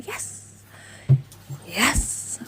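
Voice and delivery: breathy whispered relief; relieved whisper. Retold or imitated. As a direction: let the yes out as a hushed sigh of relief. Relieved self-quote after reassurance.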